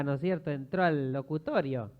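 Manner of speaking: usual speaking voice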